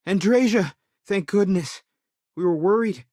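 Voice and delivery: loud, lifted cry of relief; relieved greeting. Acted. Worried friend greeting someone who is back safe, relieved greeting. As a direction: call out the name loudly, relief pouring in.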